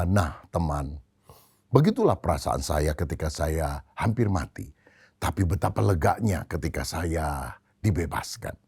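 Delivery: low, soft voice to a friend; acted gentle reassurance. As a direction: drop low and quiet, confiding after the scare. General now gently consoles the farmer. (suara rendah dan lembut menenangkan teman)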